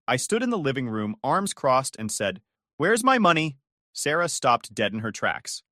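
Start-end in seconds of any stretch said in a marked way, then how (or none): 2.8-3.6 sharp, loud demand for the money; angry demand. Retold or imitated. To sister Sarah who stole the cash, a loud angry demand. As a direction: confront her with a raised voice. Confronting thief in living room.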